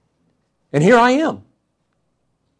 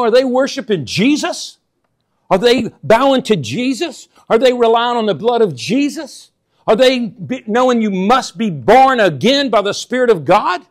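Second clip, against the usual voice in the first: slow, higher, louder rhetorical questions; preaching at full force; 4 semitones higher, 5 dB louder than usual. Acted. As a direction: hammer each question at the congregation.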